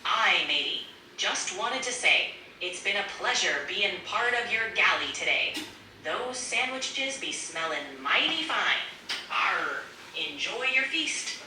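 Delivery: playful pirate lilt; pirate accent. Pretend. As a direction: talk like a jolly storybook pirate. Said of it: "a pirate accent".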